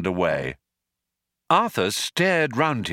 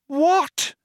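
very high, shocked shout; 3 dB louder than usual. A narrator's reading. Ford hearing his death sentence, shouting. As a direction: shoot it up in disbelief. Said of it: "shouted Ford".

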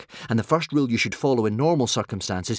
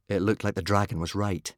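ordinary delivery

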